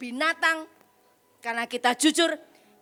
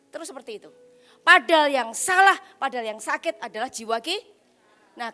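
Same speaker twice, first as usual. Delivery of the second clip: usual speaking voice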